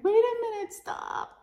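high-pitched cry then hushed stop; mock alarm. Calling to the guy clearing the salad bar, mock alarm. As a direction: squeal it, then drop away. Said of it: "I'm like".

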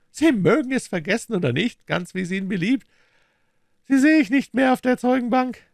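high, loud, taunting voice; scornful villain Smith. A narrator's reading. Smith berates dying Holmes, scornful high voice.

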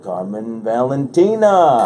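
booming, drawn-out announcer name read; radio voice. Shown as a demonstration. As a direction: stretch the name out slow and loud, radio-host style. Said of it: "doing this radio voice with your name".

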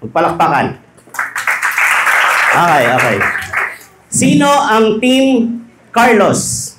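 preaching at full force. Acted. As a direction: work the room, stretch out each question. Rallies the crowd for applause.

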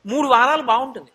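usual speaking voice

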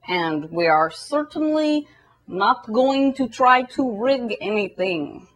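put-on deliberate Russian-accented spokesman, slowing on phrases. Russia defending its modern election system, deliberate Russian accent. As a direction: reason it out in a measured Russian accent. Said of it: "And Russia's all like".

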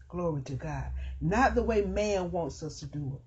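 ordinary talk, nothing marked

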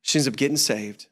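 ordinary talk

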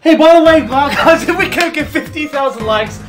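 upbeat viewer shout-out; YouTuber outro pitch. Acted. Asks viewers for likes.

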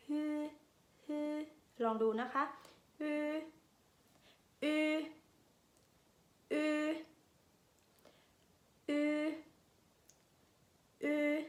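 ฮือ and อือ with lips pursed as if about to whistle; Finnish y vowel. Shown as a demonstration. Teacher drills Finnish y with whistle-shaped lips. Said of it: "กำลังจะผิวปาก".

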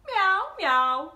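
high, loud mock meowing; cat meows. Rooster Bob meowing like a cat for the kids. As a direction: meow like a cat trying its best.

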